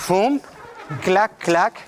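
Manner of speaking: punchy sound effects for the moves; sharp spoken percussion cues; vocal boom and clacks. Shown as a demonstration. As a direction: beat it out with your voice. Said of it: "vocalising movement accents".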